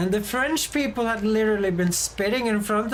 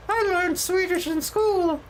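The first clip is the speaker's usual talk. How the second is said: a hint of an eager foreign student, higher; mock student; 8 semitones higher than usual. Mimicking students who claim they learned Swedish, lightly voiced.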